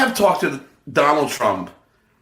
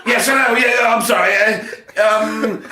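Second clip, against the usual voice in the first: put-on Sean Moore accent; 5 semitones higher than usual. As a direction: slip back into the fake Sean Moore accent, a bit nervous. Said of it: "I gotta be Sean Moore".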